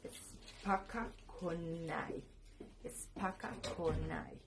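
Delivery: Spaka Konai drawn out slowly; pronunciation of Spaka Konai. Shown as a demonstration. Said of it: "y se pronuncia". (pronunciación lenta de Spaka Konai)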